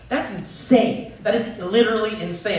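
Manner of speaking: comic outraged disbelief; incredulous. Real, not acted. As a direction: hammer home how crazy it is. Exclaiming about mother.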